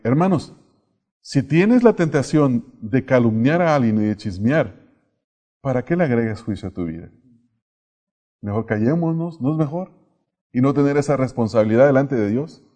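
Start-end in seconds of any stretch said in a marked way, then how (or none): none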